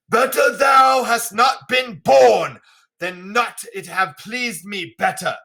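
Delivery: high, slow theatrical shout; stagey, drawn-out royal outburst; wrathful King Lear. Acted. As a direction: roar it out slowly as a cruel royal father. Lear rages at Cordelia.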